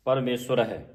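ਪਰਮੇਸ਼ਵਰ with an u after sh; correct pronunciation. Shown as a demonstration. Teacher models ਪਰਮੇਸ਼ਵਰ for students. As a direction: say ਪਰਮੇਸ਼ਵਰ as parmesur, with a light h in ਹੈ. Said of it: "ਹਾਹੇ ਦੀ ਆਵਾਜ਼ ਪਿੱਛੇ ਉਚਾਰਨ ਹੋਵੇ".

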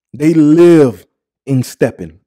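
loud hyped emphasis; selling how they dress; hyped shout. Real, not acted. Praising his friends' style, a loud hyped call.

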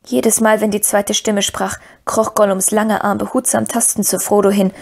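plain reading voice

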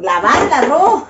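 slow, loud incredulous question; mock surprise. Reading the instructions to viewers, slow mock disbelief. Reacting to washing-rice instruction.

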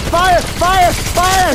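loud, high calling out. Real, not acted. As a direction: yell for your teammate. Calling teammate for help in game.

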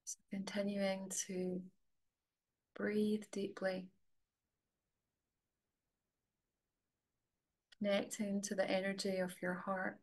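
soft, level voice with long pauses; guided meditation. Real, not acted. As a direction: gently guide them inward, leaving space to breathe.

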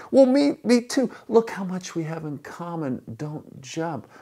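high excitement, bursting loud then softening; hammed-up delight that tapers into a plea; overjoyed. Rescuer to his exact co-believer on the ledge, overjoyed.

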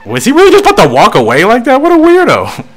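high, loud, fast disbelief; incredulous outburst. Acted. Jockey watching the trainer walk away, high incredulous outburst. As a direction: squeal it in amused disbelief. Reacts laughing as trainer walks off.

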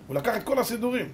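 ordinary talk, nothing marked

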